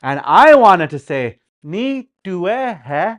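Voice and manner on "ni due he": "Ni due he" slowly, a gap after each word; separate words. Shown as a demonstration. Learner sounding out Dakota for grandpa, slow word by word. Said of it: "Like that".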